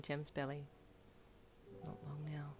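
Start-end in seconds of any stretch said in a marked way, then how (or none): 1.8-2.5 very soft, tender murmur; whispered. A narrator's reading. Husband to pregnant wife in bed, hushed whisper. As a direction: whisper it close to her neck. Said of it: "he whispered with a kiss".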